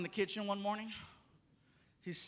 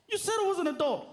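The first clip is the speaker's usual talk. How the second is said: put-on high, loud demanding child; high bratty demand; kid voice; 9 semitones higher, 4 dB louder, faster than usual. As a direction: whine it like an insistent little boy. Son argues.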